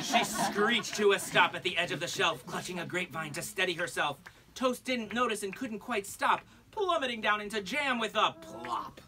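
lilting rhymed read-aloud; singsong picture-book reading; storybook narration. Acted. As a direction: read it to a room of kids.

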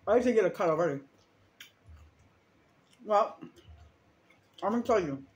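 chewing while talking; talking through a mouthful; mouth full. Real, not acted. Seen 4: Bites orange food at 0.2, then chews with hand over mouth.

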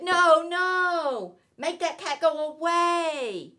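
high, loud, alarmed fish voice; a fussy fish crying out in protest. Acted. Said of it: "But our fish said".

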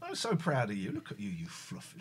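low, soft, tender pet talk; sweet nothings. Real, not acted. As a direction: murmur love to the cat.

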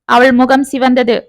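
usual speaking voice

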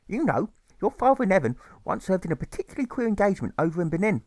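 mother's voice. Acted. Mother tells story.

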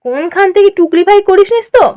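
lilting, pat-on-the-back tone; patronizing praise. Acted. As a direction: praise them like an indulgent elder, then tease. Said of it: "বেশ পিঠ চাপড়ানো সুরে বলল".

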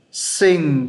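"Sinh" drawn out, falling; slow blended sound. Shown as a demonstration. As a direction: hold the final ng of "Sinh". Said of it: "chậm nó sẽ là như thế đấy".

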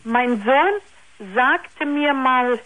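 usual speaking voice

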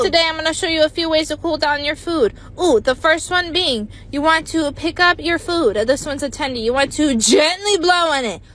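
ordinary talk, nothing marked

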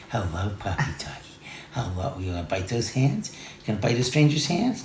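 soft doting voice for a dog; puppy talk. Real, not acted. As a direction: coo gently at the puppy. Cooing to the puppy.

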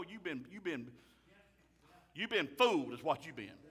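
ordinary talk

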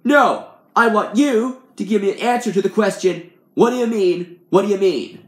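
loud, high rant; fuming, shouted insistence; angry demand. A narrator's reading. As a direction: yell it in exasperation, hitting key words. Aggravated man demands an answer.

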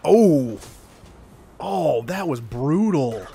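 loud, drawn-out "oh" at the hit; impressed reaction. Real, not acted. Player reacting to a brutal hit in game, loudly impressed. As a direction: wince out loud, stretching the words slowly.

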